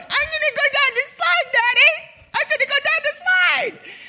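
put-on very high, loud child voice; little kid. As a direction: squeal like an excited little boy. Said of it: "I'm like".

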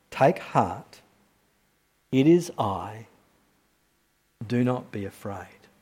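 low, soft, slow words of comfort; calm them like a frightened child; gentle reassurance. Acted. Jesus reassuring the disciples.

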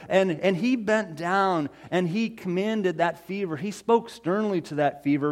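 usual speaking voice

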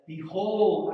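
loud ringing behold; proclaiming. Acted. Preacher booming the opening word of the prophecy. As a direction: announce it to the whole crowd.

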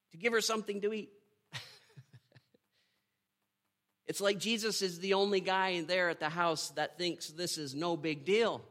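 ordinary delivery

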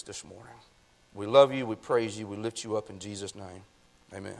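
low, soft pastoral prayer. Real, not acted. Preacher asking the Father to bring anointing, hushed prayer. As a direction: pray quietly, dropping low at phrase ends.

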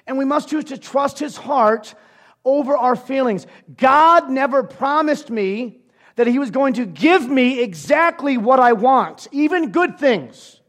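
loud, driving sermon voice; preaching at full force. Acted. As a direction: preach it out to the congregation.

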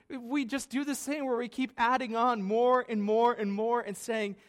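ordinary talk

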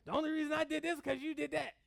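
high, quick mock accusation; mock blaming. Preacher mimicking excuse-makers blaming others, mock accusing. Acting out someone shifting blame.

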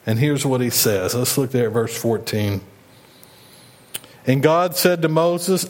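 ordinary delivery

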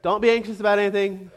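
fast, breezy brush-off of the verse; rushed throwaway. Shown as a demonstration. Preacher mocking a verse cut short, rattled off fast. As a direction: rattle it off like that settles everything. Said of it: "And he just stops there".

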